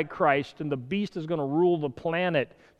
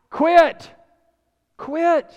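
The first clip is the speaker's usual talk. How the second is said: sharp high yell of quit; shouted command; 11 semitones higher, 7 dB louder than usual. Pretend. God yelling at Kelly to quit, loud and high. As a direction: bark the order twice, much louder.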